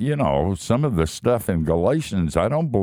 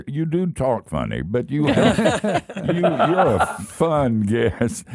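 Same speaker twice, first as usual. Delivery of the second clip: laughing through the words, slower; 6 semitones higher than usual. Real, not acted. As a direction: tease him while laughing. Teasing the guest, laughing.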